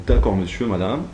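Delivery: ordinary talk, nothing marked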